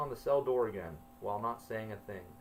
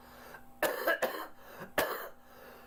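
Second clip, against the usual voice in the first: coughing fit. Real, not acted. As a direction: cough hard between sentences. Narrator's own coughing fit.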